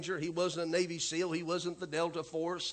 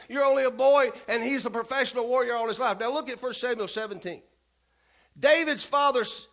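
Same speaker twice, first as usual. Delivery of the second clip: loud, raised scripture storytelling; preaching; 6 semitones higher, 8 dB louder than usual. Acted. Pastor rereading Samuel to congregation, raised preaching. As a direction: proclaim the story to the room.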